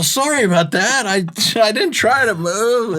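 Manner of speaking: high, sheepish player voice; mock apology. P J apologizes to his driver for moving, high and sheepish.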